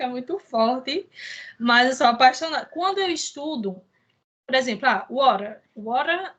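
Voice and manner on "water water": American "water", T tapped like a quick D; American accent. Shown as a demonstration. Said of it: "é no sotaque americano".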